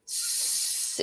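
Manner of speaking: s-, voiceless, tongue at the teeth; a lispy whistling s-; dental s demo. Shown as a demonstration. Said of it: "almost like a lispy sound".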